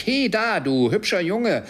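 high, loud hailing call. A narrator's reading. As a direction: hail a boy down in the street. Scrooge calls down to the boy. (laut und hoch hinuntergerufen)

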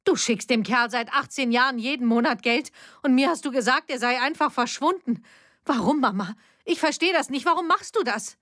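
high, agitated confrontation; upset daughter. A narrator's reading. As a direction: demand answers, voice climbing. Silvia confronts mother, agitated.

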